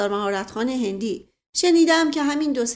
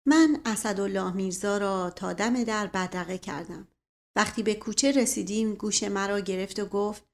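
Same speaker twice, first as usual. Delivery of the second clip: plain reading voice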